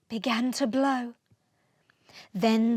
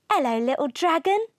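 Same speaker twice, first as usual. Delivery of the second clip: put-on fast, higher, louder friendly child greeting; small friendly voice; 4 semitones higher, 5 dB louder, faster than usual. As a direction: greet the lost dragon like a cheerful island boy. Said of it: "said a small and friendly voice".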